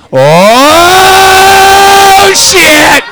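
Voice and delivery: high, loud, drawn-out exclamation; excited shout. Real, not acted. Comic reacting to audience members walking out, excited high shout. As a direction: holler it long and high in amazement.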